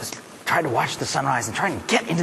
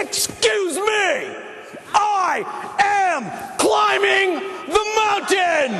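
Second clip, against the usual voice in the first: defiant shout; much slower than usual. Acted. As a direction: plant your feet and shout your refusal. Climactic shouted declaration.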